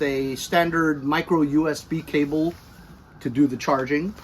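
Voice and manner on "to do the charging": usual speaking voice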